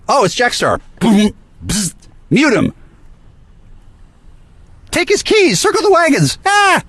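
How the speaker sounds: very high-pitched mimicked alarm; mock panic. Chat members shutting out Jackstar, mock panic. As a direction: squeal it like a flustered gang raising the alarm.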